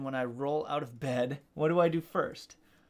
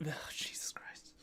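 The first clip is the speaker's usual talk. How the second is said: whispered curse; 16 dB quieter than usual. Real, not acted. Flubs the title on camera.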